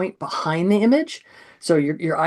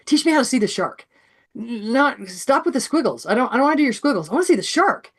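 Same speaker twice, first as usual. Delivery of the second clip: much higher, urgent begging to be shown; eager, pleading kid; 5 semitones higher than usual. Retold or imitated. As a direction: play a kid desperate to see it. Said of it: "a kid that sees this and goes".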